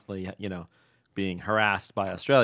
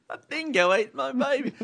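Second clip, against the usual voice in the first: high, hammed-up Australian dingo line; mock Australian accent; 13 semitones higher than usual. Host echoing the dingo cliché, high mock Australian accent. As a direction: squeal the cliché in a put-on Aussie voice.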